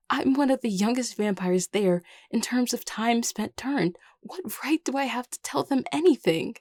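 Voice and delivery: high, distressed protest; overwhelmed. Acted. New crown doubting their right to lead, overwhelmed.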